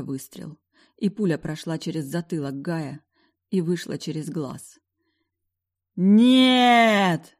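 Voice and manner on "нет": loud anguished scream. Acted. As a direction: scream in horror. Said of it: "крик Джейса одновременно со своим".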